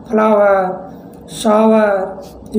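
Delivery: फ्लावर and शावर drawn out very slowly, each sound clear; slow model words. Shown as a demonstration. Teacher to students, modelling the words very slowly. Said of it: "ऐसे ही next example हो गया".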